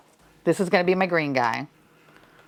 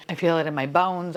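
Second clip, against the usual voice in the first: usual speaking voice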